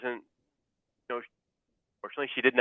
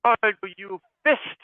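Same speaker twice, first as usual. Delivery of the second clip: put-on mom's German accent, much higher and slow; slow, high-pitched German-accented mom question; thick German accent; 10 semitones higher, 6 dB louder, slower than usual. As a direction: imitate a mother's heavy German accent, drawn out. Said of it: "she said in her thick German accent".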